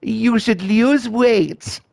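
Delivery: high, slow, haughty posh lady; mocking a snooty older woman, high and slow. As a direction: look down your nose and drawl it out slowly. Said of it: "in her posh voice".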